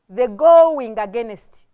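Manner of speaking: "going" hit loud, with weight; emphasis on GOING. Shown as a demonstration. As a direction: punch "going" as the key word. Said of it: "we wanted to put emphasis on".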